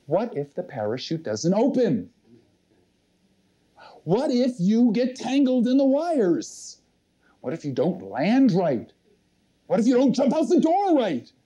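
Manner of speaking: acted-out voice of fear, rising in urgency; worried inner voice. Speaker voices their own fear in the plane door, worried inner voice. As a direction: nag yourself with mounting what-ifs. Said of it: "there was a voice going through my head".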